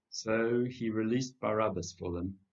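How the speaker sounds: usual speaking voice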